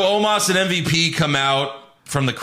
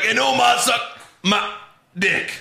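higher voice, halting between the last words; imitated wrestling promo; 10 semitones higher than usual. Host imitating the cut-off promo, higher and halting. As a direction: break it into chunks with pauses. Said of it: "he's like".

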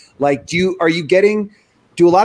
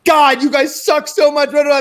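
mock teen scorn; 10 semitones higher, 4 dB louder than usual. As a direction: sneer at the younger siblings. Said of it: "she'd be like".

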